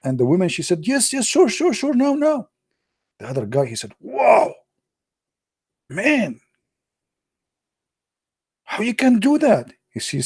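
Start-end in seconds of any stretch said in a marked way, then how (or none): 0.9-2.5 high, eager obedient wife voice; hammed-up submissive wife; meek wife. 4.0-9.7 high, amazed wow; hammed-up amazement; amazed friend.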